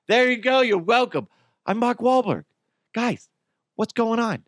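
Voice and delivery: high clumsy impression carried on; Mark Wahlberg. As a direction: keep squeaking the goofy Wahlberg. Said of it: "this is my attempt".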